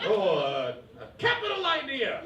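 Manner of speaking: high, hammed-up old-timey huckster; 1920s huckster. Reacting to his girlfriend like an old-timey huckster, hammed-up and high. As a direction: play a grand showman from the twenties. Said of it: "like a huckster from the nineteen twenties".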